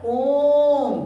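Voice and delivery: ओम held long and slow, as a plut vowel; drawn-out om. Shown as a demonstration. Teacher shows pupils the long plut vowel: drawn-out ओम. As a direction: stretch the ओम slowly to show a long vowel. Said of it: "बच्चों, इसको क्या पढ़ाता है?".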